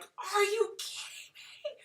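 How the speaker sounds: very high, slow, stretched-out disbelief; thrilled squeal. Real, not acted. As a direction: squeal with joy behind a muted phone. Muted phone, thrilled private reaction.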